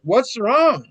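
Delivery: high-pitched baffled question; retold puzzlement. Soaked man to his shocked friend, retold puzzlement. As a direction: act out confusion, pitched right up.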